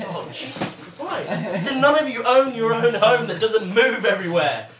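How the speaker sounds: laughing, loud and high; cracking up in disbelief. Real, not acted.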